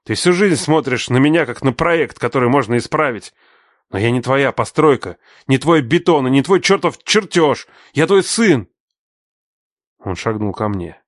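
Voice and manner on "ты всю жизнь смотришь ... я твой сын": higher, louder rising protest; anguished outburst. Acted. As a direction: build to the final cry. Son's outburst at father.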